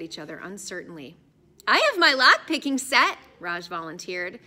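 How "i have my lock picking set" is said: eager. Acted. Raj volunteering eagerly.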